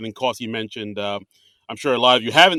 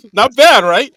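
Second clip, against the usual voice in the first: laughing, much louder; 10 semitones higher, 14 dB louder, slower than usual. Real, not acted. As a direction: laugh through it, pleased with himself.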